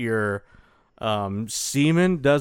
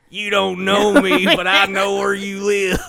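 disguised voice; 6 semitones higher, 6 dB louder than usual. Retold or imitated. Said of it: "And he goes".

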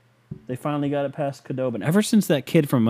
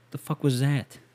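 usual speaking voice

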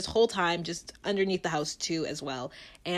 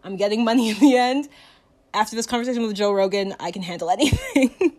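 loud, high self-psyching with a laugh; pumped-up contestant; 3 semitones higher, 7 dB louder than usual. Retold or imitated. Contestant psyching herself up to drink, loud and laughing. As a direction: hype yourself up to swallow it. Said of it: "and they're like".

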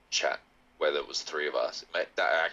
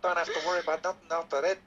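higher-pitched Kiwi explanation; New Zealand accent; 11 semitones higher than usual. Shown as a demonstration. Host explaining the trick, staying in New Zealand accent. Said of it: "let's just say you think of a New Zealand".

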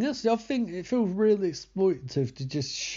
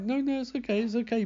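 much higher, a mock cooing mother calming a baby; soothing mum; 10 semitones higher, faster than usual. Mum calming crying Hunter, mock cooing higher voice. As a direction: hush the crying toddler, mock-tender. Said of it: "And she's like".

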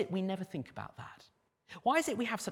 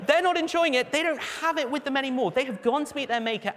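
emphatic loud; 9 semitones higher, 7 dB louder than usual. Real, not acted. Raised emphatic preaching.

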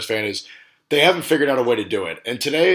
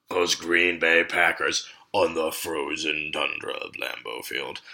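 deep, slow mock film narrator voice, lower; mock NFL Films voice; 7 semitones lower, 6 dB quieter, slower than usual. Host joking about Packers game, deep mock narrator voice. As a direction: intone it like an old football documentary.

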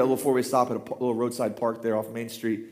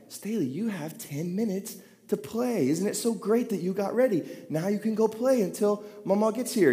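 put-on higher, softer sugary coaxing to a four-year-old; sweet coaxing dad; 7 semitones higher, 4 dB quieter than usual. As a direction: sell playtime to her like a treat. Dad sweetly coaxing his daughter.

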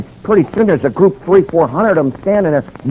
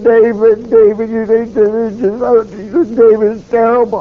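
higher, choked-up friend on the phone; retold sobbing; 5 semitones higher than usual. Bob on the phone to the speaker, mild mock sobbing. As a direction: imitate a man crying down the line. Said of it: "sobbing uncontrollably".

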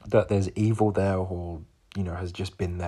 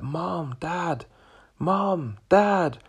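mock cries for help, high and building louder; retold screaming; 9 semitones higher, 5 dB louder, much slower than usual. Terrified seven-year-old calling his parents in a nightmare, retold screaming. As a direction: call out high, like a panicked child, building. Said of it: "screaming and crying and shouting".

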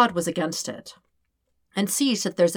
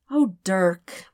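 knowing tone. Acted. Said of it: "the tone that Dirk has now come to learn".

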